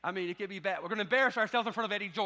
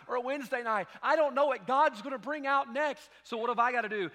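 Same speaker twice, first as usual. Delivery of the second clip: louder and higher, urging the church; fiery preaching; 7 semitones higher, 6 dB louder than usual. Real, not acted. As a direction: preach it at full force. Preacher builds to full force.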